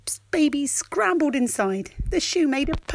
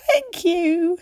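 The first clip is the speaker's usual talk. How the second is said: high, grateful little fox; small high fox thanks; 8 semitones higher, 4 dB louder than usual. Acted. Fox character voice.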